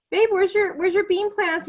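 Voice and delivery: gentle coaxing question. Retold or imitated. Teacher gently asking sad student.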